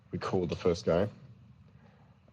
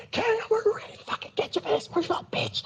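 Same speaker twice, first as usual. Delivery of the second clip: very high-pitched strained screaming; sudden yell. Acted. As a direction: scream abuse at a phone thief across the room. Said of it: "I just yell out".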